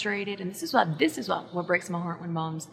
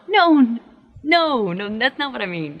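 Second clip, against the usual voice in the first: loud, high "no" that settles down; firm interruption, starting loud and easing off; emphatic self-correction; 3 semitones higher, 10 dB louder, slower than usual. Acted. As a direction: cut yourself off with a loud objection. Cuts off a wrong self-blaming example.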